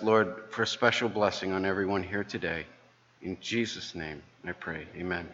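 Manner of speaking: soft prayer voice that fades at the close; hushed church prayer. Real, not acted. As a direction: pray quietly, trailing off toward amen. Leads the opening prayer.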